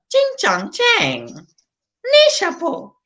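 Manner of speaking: high, slow mock Chinese gibberish. Comedian faking languages, high slow mock Chinese. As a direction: drag out fake Chinese syllables high. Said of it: "I speak a lot of language".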